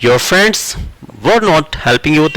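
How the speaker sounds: slow, deliberate, words spaced; slow dictation. Shown as a demonstration. Teacher repeating the sentence for students, slowly. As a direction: read it out slowly again. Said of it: "ऐसे बन जाएगा sentence".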